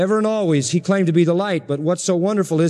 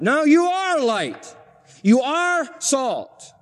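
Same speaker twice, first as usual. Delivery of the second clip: high, very slow, every word hammered; slow insistence; 7 semitones higher, much slower than usual. Acted. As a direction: drive each word home slowly like a verdict. Preacher insists on being salt.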